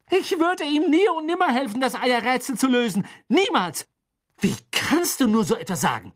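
very high, loud protest; outraged denial. Acted. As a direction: protest as if deeply insulted. Hermine outraged by Ron's accusation.

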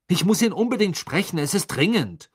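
high, loud, insistent outburst; heated, pressing plea; angry urgency. A narrator's reading. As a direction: demand it urgently, temper rising. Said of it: "sagte er wütend".

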